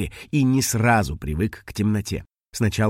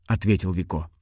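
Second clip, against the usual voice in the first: plain reading voice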